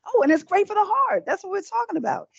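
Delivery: high, eager rush of remembering; light up as the point comes back; excited. Real, not acted. Telling viewers quinoa helps the heart, high and excited.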